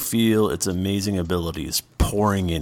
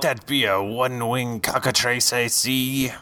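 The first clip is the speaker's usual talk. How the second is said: gruff sea-dog pirate, higher; 5 semitones higher than usual. Acted. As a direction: growl it like an old pirate. Pirate NPC greets the party.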